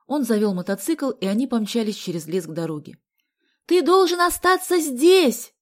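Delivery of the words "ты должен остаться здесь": high, drawn-out loud shout. A narrator's reading. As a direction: yell it slowly into his ear. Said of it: "закричала ему Галя на ухо".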